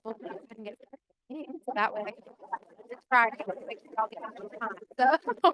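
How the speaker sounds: muffled, mumbled bad phone line; underwater sound. Real, not acted. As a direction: voice garbled like underwater. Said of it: "it sounds like you're underwater".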